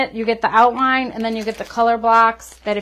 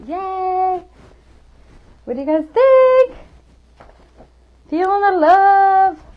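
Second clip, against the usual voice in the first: much higher, bright gushing to the viewers; excited cheering; 9 semitones higher, 4 dB louder, slower than usual. Real, not acted. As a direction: gush happily at the live chat.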